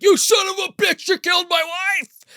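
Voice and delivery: enraged shout. Retold or imitated. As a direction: scream it at the man who killed your wife. Father attacking Ralph, retold.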